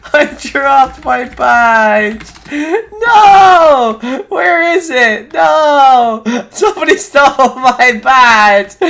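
high, loud wails mixed with laughs; laughing despair. Real, not acted. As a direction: howl at the loss, half laughing. Badge stolen, laughing in despair.

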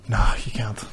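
soft whispered "No, you can't" to himself; whispered aside. Retold or imitated. Shoplifter telling himself not to run, low whisper. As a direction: hush it, alarmed at yourself. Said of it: "and I was like".

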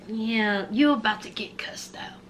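hushed brotherly warning; whisper. Retold or imitated. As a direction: warn him under your breath. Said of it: "he said".

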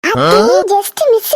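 slow, hammed-up sob; mock crying. Son fakes a cry over a near miss, slow hammed-up sob. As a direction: drag it out as a fake wail.

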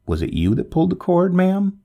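high-pitched brusque questioning; narrator's understated gruff official; somewhat rough. A narrator's reading. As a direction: demand an explanation, mildly roughly. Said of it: "he asked somewhat roughly".

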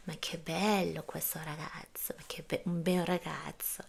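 slow, hushed whisper to oneself; inner thought whisper. Retold or imitated. Teacher voicing her thoughts about a handsome passer-by in a whisper. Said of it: "เราก็คิดในหัวไปด้วย".

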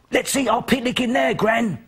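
put-on high, eager little boy voice; a small boy pleading sweetly to eat in the den; young boy. Little boy asking his gran to picnic in the den, eager high voice.

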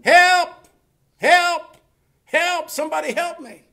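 high, loud cries fading on the last plea; screaming for help. Retold or imitated. Man hanging off a cliff calling for rescue, high mock scream. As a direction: mimic a desperate yell, far above normal pitch. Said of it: "started screaming".

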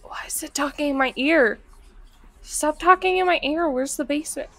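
ordinary talk, nothing marked

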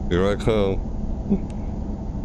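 very slow drawn-out line, rising at the end; dramatic read. Shown as a demonstration. Reading the game's opening line, slow and ominous. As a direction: stretch it out like an ominous threat. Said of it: "No exclamation mark".

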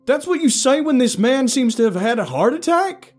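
high, loud outraged tirade; furious indignant yell; angry shout. A narrator's reading. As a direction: shout in disbelief. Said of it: "Kent shouted angrily".

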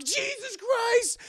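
very high, slow shocked yell; startled scream. Retold or imitated. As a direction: jump out of your skin. Couple startled by explosion.

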